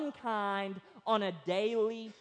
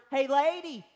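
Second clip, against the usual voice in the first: parrot calling out; 5 semitones higher, 5 dB louder than usual. Acted. Parrot calls out to passing lady.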